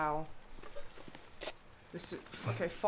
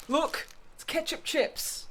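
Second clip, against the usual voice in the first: excited; 10 semitones higher, 5 dB louder, slower than usual. Real, not acted. Finds favourite snack.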